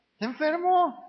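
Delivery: high, firm, defensive insistence; mock insistent excuse. Preacher mimicking a relative who defends a drunk, insistent and high. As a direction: mockingly insist he is just sick.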